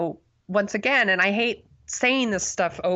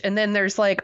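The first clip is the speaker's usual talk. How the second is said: ordinary delivery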